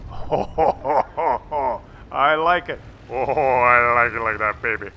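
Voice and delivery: loud, low mock-seductive purr; playfully seductive victory line; sultry gloat. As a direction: croon it to the tank, hammed-up. Gloating over a hit.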